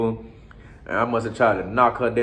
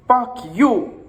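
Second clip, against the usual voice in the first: much higher, sharper curse; mimicked girlfriend's curse; 10 semitones higher than usual. Girlfriend punching him and cursing, voiced much higher. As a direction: spit it out up high like the furious girlfriend. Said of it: "She says".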